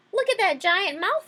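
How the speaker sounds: high, loud cry of a wary fish; alarmed exclamation. Acted. Suspicious fish exclaims to the others about the whale, alarmed. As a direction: point out the danger loudly, pitched right up.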